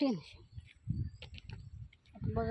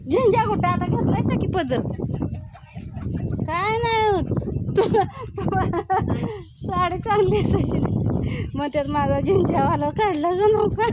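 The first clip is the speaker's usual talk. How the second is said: loud chat; 6 semitones higher, 9 dB louder than usual. Real, not acted. Woman talking to an elderly neighbour about her sari, loud. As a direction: speak up so she can hear.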